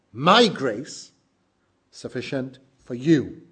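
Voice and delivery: "My" punched loud, "you" pressed at the close; stress on My and you. Shown as a demonstration. Preacher repeating the verse with the emphasis on "My" and "you". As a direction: lean hard on "My" and finish on "you". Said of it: "an emphasis on the first and the last word".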